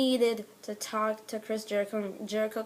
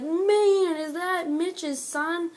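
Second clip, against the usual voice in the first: high, loud then slow amazement; imitating a surprised commentator; mock amazed; 8 semitones higher, 5 dB louder, much slower than usual. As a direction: gawk at it like a commentator. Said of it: "he's like".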